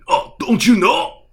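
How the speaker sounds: know-it-all orc. Retold or imitated. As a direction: lecture like a smug dim orc. Orc explains helmets.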